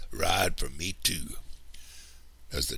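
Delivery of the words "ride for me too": ordinary delivery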